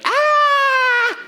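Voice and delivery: high shrieking yell of terror; panicked scream. Shown as a demonstration. Pastor translating the disciples' panic, high scream. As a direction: let out a loud terrified cry. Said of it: "If I could just translate it".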